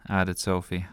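ordinary delivery

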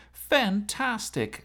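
high, slow drawn-out shout. Acted. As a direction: stretch out a happy shout. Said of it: "shouted Gordon".

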